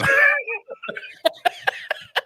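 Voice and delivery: high-pitched burst of laughter; big laugh. Real, not acted. Friend cracking up at his own joke, high laughter.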